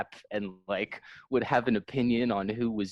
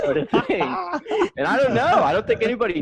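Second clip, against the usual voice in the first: laughing; 13 semitones higher, 4 dB louder, faster than usual. Real, not acted. Laughing at his own joke.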